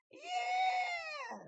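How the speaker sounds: high, drawn-out squeal of yeah; excited kid cheer. Retold or imitated. Kids answer the offer to ride, excited squeal. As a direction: cheer like a thrilled little kid.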